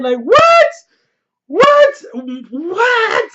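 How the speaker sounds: very high, loud shocked cry; stunned disbelief. Real, not acted. Fan reacting to the dragon scene, shrieking in shock.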